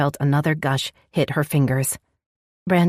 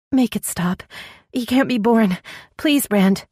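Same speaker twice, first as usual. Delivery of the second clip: desperate pleading; 7 semitones higher than usual. Acted. Woman begs in pain.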